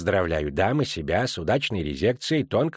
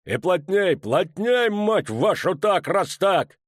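high, loud shouted orders; dwarf roars orders to the ranks; growled commands; 7 semitones higher, 3 dB louder, slower than usual. A narrator's reading. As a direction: drive the ranks forward. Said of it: "рычал Барклай Эльс".

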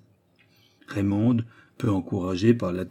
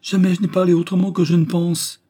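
high, loud protest; indignant cry; 10 semitones higher, 6 dB louder than usual. A narrator's reading. Raymonde defending her sincerity to her host, indignant cry. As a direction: insist on your honesty, voice raised. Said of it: "s'écria Raymonde".

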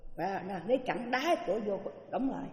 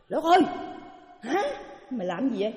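put-on girl shouting in shock at the monk; shocked outburst; 3 semitones higher than usual. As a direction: yell in disbelief. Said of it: "Cái con này nó".

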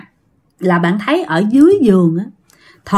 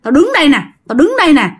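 high, insistent retold warning; fierce uncle; 6 semitones higher, faster than usual. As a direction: imitate an uncle standing guard. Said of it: "mặt bác hai dữ lắm".

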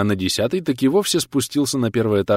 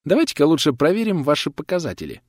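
higher, lightly voiced young woman; smiling woman's voice, higher; smiling girl; 7 semitones higher than usual. A narrator's reading. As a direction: suggest it cheerily, pitched up. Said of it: "улыбнулась девушка".

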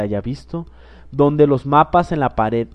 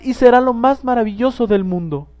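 put-on high, thrilled child's voice; wide-eyed excitement; 9 semitones higher than usual. A narrator's reading. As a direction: Fan promising her brother a wonderful Christmas. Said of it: "dijo la niña, abriendo los ojos".

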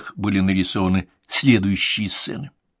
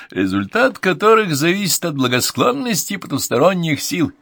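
charming showman; 8 semitones higher than usual. Acted. Medium charms audience.

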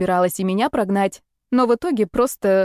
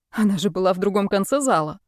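ordinary delivery, nothing marked

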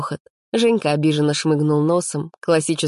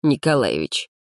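mumbled correction; 4 semitones lower than usual. A narrator's reading. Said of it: "промямлил препод".

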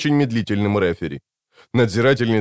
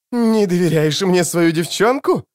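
higher-pitched, needling bark; barked challenge; 8 semitones higher than usual. A narrator's reading. Prisoner challenging another man's trust, a lightly acted bark. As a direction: snap the question as a challenge, mildly acted. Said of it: "рявкнул Конёчек".

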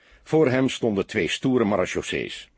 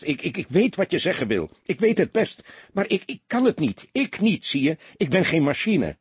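much higher, stammering, rising desperation; uncontrolled outburst; 7 semitones higher than usual. A narrator's reading. Young detective defends himself against his boss, high desperate outburst. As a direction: let it spill out, barely in control. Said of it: "schreeuwde hij wat onbeheerst".

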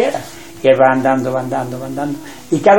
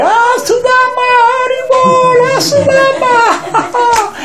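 much higher, slow drawn-out joyful cry of his name; much slower than usual. Acted. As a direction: sing out his name in delight like a thrilled wife. Wife greets returning Sudama joyfully. (esposa llamándolo a gritos de alegría, muy aguda y alargada)

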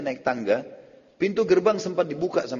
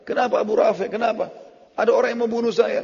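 changed, disguised voice; 9 semitones higher, 3 dB louder than usual. Retold or imitated. Abdullah disguises his voice to fool Abu Rafi, higher and louder. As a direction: voice pitched well up and louder to disguise himself. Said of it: "Saya ubah lagi suara saya".

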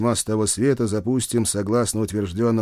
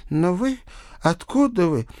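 high, halting bewilderment; taken aback, voice raised; stunned stammering; 10 semitones higher than usual. A narrator's reading. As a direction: stumble through the questions in shock. Nephew stunned by his dead aunt.

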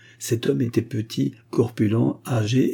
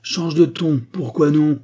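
raised, higher voice of an angry customer; indignant, loud and high; angry challenge; 6 semitones higher, 5 dB louder than usual. A narrator's reading. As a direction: challenge the refusal loudly. Customer angry at being refused.

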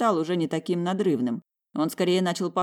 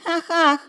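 put-on higher, louder baby snuffling; baby sniffling; 14 semitones higher, 7 dB louder than usual. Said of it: "начал сопеть он".

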